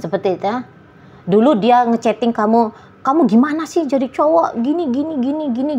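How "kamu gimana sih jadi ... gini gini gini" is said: higher, nagging partner voice; complaining partner. Retold or imitated. Partner nagging you over chat, in a higher mock complaining voice.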